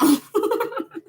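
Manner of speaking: shrill-free high cackling laugh; high cackle. Real, not acted. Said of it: "I be cackling".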